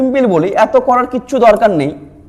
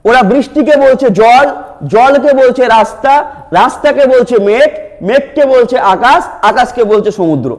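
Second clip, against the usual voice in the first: slow explaining; slower than usual. Shown as a demonstration. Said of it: "একটু যদি আমরা এটাকে একটু পড়ি ডিটেলসে".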